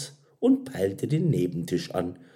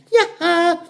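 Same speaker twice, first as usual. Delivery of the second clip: usual speaking voice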